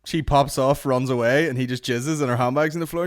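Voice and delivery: usual speaking voice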